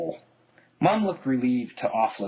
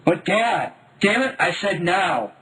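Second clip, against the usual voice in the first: angry; 7 semitones higher, 4 dB louder than usual. A narrator's reading. Child protests, dad snaps.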